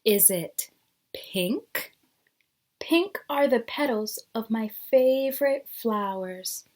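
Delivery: lively storybook reading with rising questions; teacher reading a picture book to the class; reading to children. Acted. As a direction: read each guess like a curious cat. Reads the picture book aloud.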